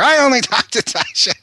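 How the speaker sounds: high-pitched imitation of an old lady, breaking into laughter; imitating old lady. Old woman shooing visitors away.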